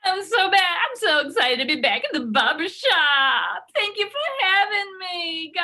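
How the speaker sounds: overjoyed guest back on the show; gushing mock excitement. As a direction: guest thrilled to return to the show.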